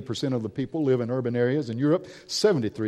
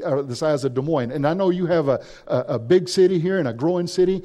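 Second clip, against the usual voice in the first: ordinary talk, nothing marked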